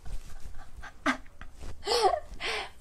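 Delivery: helpless wheezy laugh; real, drawn-out giggling fit. Real, not acted. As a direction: crack up at your own silliness. Amused by own pig imitation.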